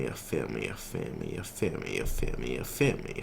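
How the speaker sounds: hushed, lazy put-on voice; put-on cool character. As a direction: murmur it like a mock smooth talker. Holds put-on slow voice.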